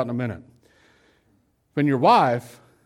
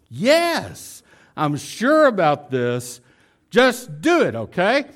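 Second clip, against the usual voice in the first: high, insistent acted impatience; mock-exasperated urging; exasperated wife; 5 semitones higher, slower than usual. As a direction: jump up in pitch and press your husband. Acted wife losing patience.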